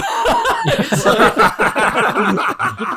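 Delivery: loud high cackling laugh; big burst of laughter. Real, not acted. Friends laughing at a stat joke, loud laugh.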